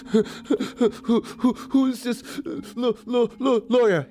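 high, stuttering mock sobs; imitated sobbing. Crying nephew asking about the lawyer, imitated sobbing. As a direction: stammer it through acted, hiccupping sobs. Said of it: "it was enough to make me stop crying".

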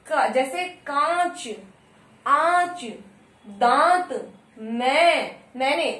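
कांच, आंच, दांत said slowly through nose and mouth; nasal vowel demo. Shown as a demonstration. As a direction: sound the nasal vowels clearly. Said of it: "जैसे बोल के देखिए". (नाक और मुख दोनों से अनुनासिक उच्चारण)

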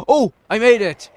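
high, loud burst of delight; thrilled surprise. Real, not acted. Gamer unexpectedly making the jump, thrilled shout. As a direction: exclaim in amazed triumph.

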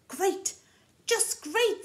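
high, loud delighted cry; excited goat voice for the children. Acted. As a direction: squeal it with joy.

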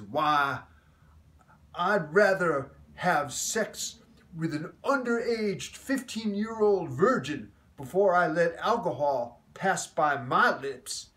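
slow booming Southern drawl, loud and solemn. Retold or imitated. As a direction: thunder it out like a dead-serious Southern Baptist minister. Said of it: "in his booming, southern drawled voice, very serious man".

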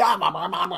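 rapid mock babble of syllables; imitated babbling. Man mimicking puzzling church worshippers, babbling. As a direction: imitate someone babbling in a wild church service.

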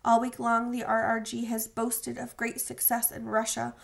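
usual speaking voice

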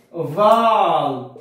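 فال drawn out very slowly, each sound clear; slow word demo. Shown as a demonstration. Teacher shows students the Coptic word, slowly drawn out. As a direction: stretch فال long and slow so every sound is heard. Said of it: "زي كلمة مثلًا إيه؟".

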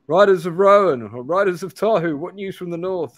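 called out high and loud; commanding challenge from horseback; sergeant's hail. Acted. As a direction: hail the riders like a wary soldier. Sergeant hails the travellers.

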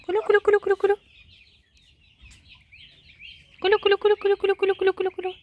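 high sing-song calling to poultry; high repeated call to the turkeys. Real, not acted. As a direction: call the birds in with a high chant.

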